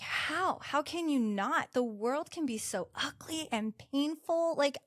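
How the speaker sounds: imitating her incredulous upset; agitated disbelief. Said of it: "And she was like".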